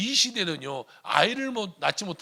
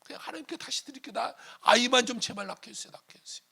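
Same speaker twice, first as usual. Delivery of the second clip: high, hurried begging, fading at the end; pleading; 8 semitones higher than usual. Acted. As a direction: plead under pressure, then tail off. Acted plea to be given a child. (높고 다급하게 애원하듯)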